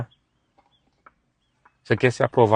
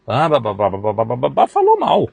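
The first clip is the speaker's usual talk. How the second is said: mocking babble; 3 semitones higher, 6 dB louder than usual. Mocking gossip chatter.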